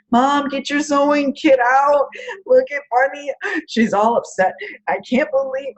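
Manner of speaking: put-on high, pleading child voice; upset daughter. Mom retelling daughter begging her to sew Bunny, high upset child voice. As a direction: imitate the tearful twelve-year-old.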